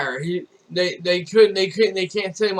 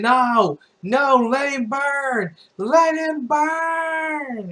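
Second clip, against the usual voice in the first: high, drawn-out holler; 8 semitones higher, much slower than usual. Retold or imitated. Firefighters told to let him burn, hollering. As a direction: imitate a crowd hollering, stretching the last words long. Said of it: "they kept hollering".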